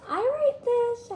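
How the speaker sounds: squeaky high voice. Pretend. Playful rating, high squeak.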